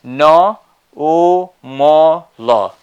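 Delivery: normal broken into loud separate syllables. Shown as a demonstration. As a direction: sound out "no, o, mo, lo" one beat each. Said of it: "And the next one is".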